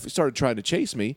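ordinary delivery

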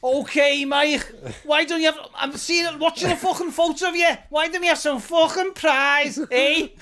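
Scouse wind-up merchant laying into his mate; angry Scouse bloke. Retold or imitated. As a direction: have a go in a Scouse accent, needling and aggressive. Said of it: "impersonations of his angry Scouse bloke".

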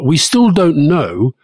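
normal talking voice

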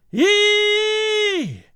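very high, long screech; piercing sustained squeal; high metallic shriek. A narrator's reading. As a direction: shriek like steel on steel. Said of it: "shrieked the high sound of steel".

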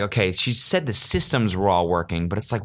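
normal talking voice

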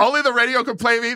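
quick, high, sassy delivery; sassy line. Retold or imitated. Host quotes [name]'s lyric, quick and sassy. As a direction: toss the line off with sass. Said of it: "the sassiest line [name] has ever written".

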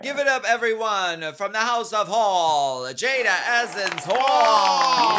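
high, loud emcee introduction, drawn out; rousing crowd-warming shout; announcer hype. Pretend. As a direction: belt out the guest's name like a show host. Hypes up the guest introduction.

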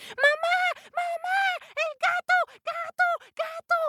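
put-on very high squeaky cries of terrified baby mice; panicked squeaky mice. Little mice screaming for mama.

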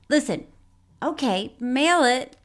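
ordinary talk, nothing marked

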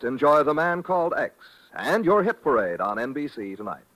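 ordinary delivery

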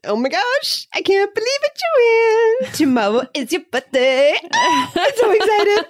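high, loud squealing birthday gush; gushing excitement. Acted. Cohost gushing to Joanne about her birthday, squealing excitedly. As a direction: squeal it like a giddy friend.

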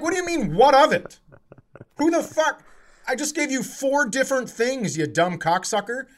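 high-pitched enraged rant; losing it. Retold or imitated. Still yelling at the driver in the parking lot, high and furious. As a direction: keep screaming at him in disbelief. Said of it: "Now I lose my mind".

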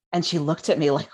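ordinary talk

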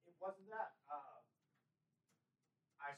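strained voice after swallowing wrong; throat still raw from coughing; hoarse after choking. Real, not acted. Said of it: "I swallowed wrong".

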